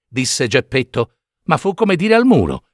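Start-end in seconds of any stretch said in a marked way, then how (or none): none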